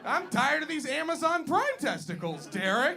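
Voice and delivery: high, loud exasperated whine; hammed-up indignant yelling; mock outraged shout. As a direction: rant it like a fed-up customer. Acting out his own complaint.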